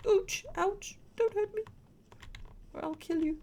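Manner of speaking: high yelping ouch then threat; yelp, then mock-threaten the attacker; pained threat. Real, not acted. Attacked by a creature in game.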